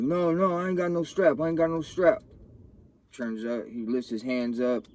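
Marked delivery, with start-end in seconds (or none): 0.0-2.2 panicked denial. Retold or imitated. Friend denying he has gun.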